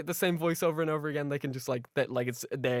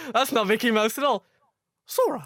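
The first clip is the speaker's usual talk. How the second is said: cartoon voice; 6 semitones higher, 6 dB louder than usual. Kingdom Hearts voices bit.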